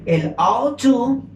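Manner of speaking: slow, drawn-out modal verbs; slow model words. Shown as a demonstration. Teacher presenting two modal verbs slowly to the class. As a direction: stretch out each modal. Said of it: "existen dos palabras".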